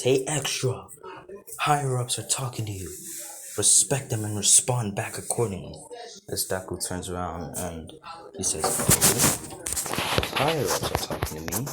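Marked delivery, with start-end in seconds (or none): 0.0-5.8 mild yell, higher at first; yelled command. A narrator's reading. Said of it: "he yells out and says". 8.6-11.7 quick questioning echo with feigned awe; mock surprise. A narrator's reading. Mocking echo of the shouted order.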